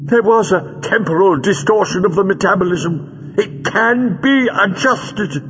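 high, strained villain voice; high-pitched villain arguing; agitated villain. Acted. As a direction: insist shrilly on your case, drawing words out.

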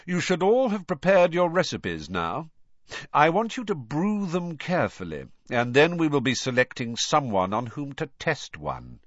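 icy, menacing schoolmaster voice; cold stern teacher. A narrator's reading. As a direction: lay down the rules coldly. Snape addresses the class.

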